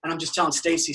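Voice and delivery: ordinary delivery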